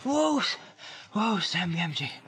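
high, drawn-out self-address; slow, high hobbit exclamation; Sam halting himself. A narrator's reading. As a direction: pull yourself up aloud. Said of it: "he said aloud".

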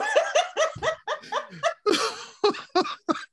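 long, high rolling laugh; long real laugh. Real, not acted. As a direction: let the laugh run on, high. Long laugh after his no.

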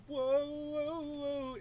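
high, drawn-out whoa; long high wailing mock scream. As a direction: imitate a scream in a near accident, stretching each whoa. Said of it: "they fucking scream when they".

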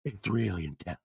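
solemn low murmur; low, hushed brooding. Retold or imitated. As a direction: brood quietly and soulfully. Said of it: "he goes".

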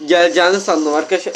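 usual speaking voice

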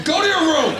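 loud, high-pitched parental order; angry dad shout. Acted. Dad sending his son off after a rude question, shouting. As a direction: bellow it as a furious dad.

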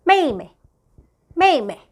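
teacher modelling 妹妹; Chinese tone demo. Shown as a demonstration. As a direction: drop 妹妹 down from high. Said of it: "여동생".

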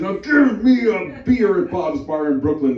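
high, loud hammed-up bellow; angry bear demand. Enraged bear demands a beer.

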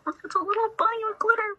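high, thrilled little discovery; delighted. Real, not acted. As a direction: gush over the glitter bunny. Finding a glittery toy.

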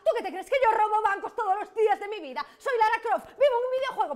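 high, loud rant of rhetorical questions; exasperated outburst. Acted. Ringleader admitting to her doubting accomplice she is unsure, exasperated. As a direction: blow up at the accomplice.

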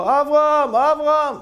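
loud, high call of a name. Acted. Hidden man calling the beadle by name, shouting. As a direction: call him loudly across the synagogue. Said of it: "הוא צועק לו".

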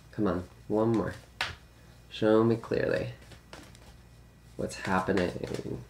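soft, slow request to the cards; quiet. Real, not acted. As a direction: murmur it softly and slowly. Softly asking the cards.

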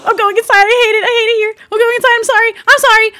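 high, loud frantic cry; panicked shout. Acted. Roleplayer panicking at the wolves, shouting high and loud. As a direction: yell it in a panic. Upset character shouting, apologizing.

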